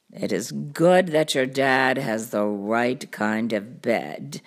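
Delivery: low cartoon cat voice; deep show-off storybook cat; boastful cat. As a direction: explain it deep and unbothered.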